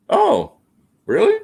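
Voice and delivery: squeaky high "Oh, really?"; surprised. Real, not acted. Reacting to the cat's pick, pitch leaps up in surprise. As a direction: jump way up in pitch, mock amazed.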